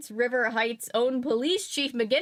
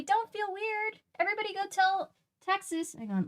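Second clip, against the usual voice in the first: ordinary talk, nothing marked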